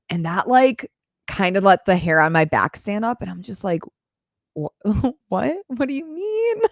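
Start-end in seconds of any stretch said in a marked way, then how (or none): 4.5-6.6 mock startled what, pitched up high; a half-laughing, baffled double take; stunned disbelief. Reacting to dad's remark.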